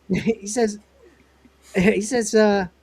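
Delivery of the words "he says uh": ordinary talk, nothing marked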